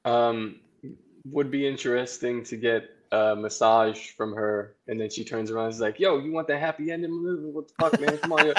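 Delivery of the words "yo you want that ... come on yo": lightly mimicked tough woman, higher pitched; playful imitation of the woman's voice; imitating her voice. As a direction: put on her voice, a bit higher and quicker. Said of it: "In that voice and be like".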